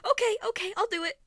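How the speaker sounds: high, loud, hurried giving in; panicked compliance. Acted. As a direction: scramble to agree before the monster turns. Player gives in to deity, panicked.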